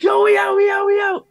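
rising from a hushed hmm to a high yell; panicked high shout. Retold or imitated. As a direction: scream and bolt like the ghost is real. Acting out being scared off.

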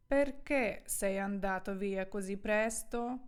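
slow, deliberate Italian sentence for learners; careful, slowed-down teacher's reading; slow model reading. Shown as a demonstration. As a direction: draw each word out for the students. Model sentence, first slow, then natural.

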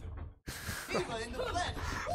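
high, soft laugh; laughter. Real, not acted. Teasing a teammate over gold medals, high laughter. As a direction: laugh quietly in a high pitch. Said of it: "I am the one who's laughing".

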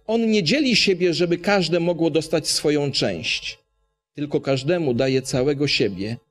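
usual speaking voice

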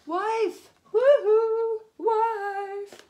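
high, loud sing-song call. Acted. Farmer calls his wife to help, loud high sing-song. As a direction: call across the field to wife. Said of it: "he called his wife".

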